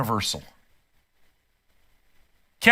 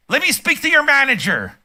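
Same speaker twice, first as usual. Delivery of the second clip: mock Karen, pitched much higher and demanding; entitled soccer mom; 8 semitones higher than usual. Mocking soccer moms who yell at clerks, high entitled Karen voice. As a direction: sneer it out like an entitled customer cornering a clerk. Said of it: "soccer moms".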